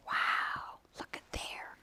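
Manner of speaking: very soft whisper; whispered wonder. Real, not acted. Reader shows children the animals following, whispered wonder. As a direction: whisper it as an amazed aside. Hushed aside over the book picture.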